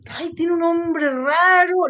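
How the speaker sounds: high, loud, drawn-out mimicry of a girl; imitated young patient. Acted quote of the young patient.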